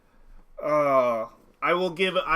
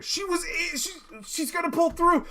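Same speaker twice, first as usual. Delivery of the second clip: very high, choked-up reading; overcome with emotion; 12 semitones higher, 6 dB louder than usual. Real, not acted. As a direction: read it high as emotion takes over. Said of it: "Too many emotions".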